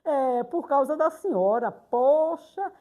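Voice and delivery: imitated grumbling that builds to a loud protest; mock aggrieved protest, building; upset pupil. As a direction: complain to the teacher, rising to a loud final "poxa". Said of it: "ficou chateada comigo".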